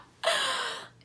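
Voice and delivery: high squealing giggle; shy high-pitched giggle; giddy laugh. Real, not acted. Laughs about cute guy.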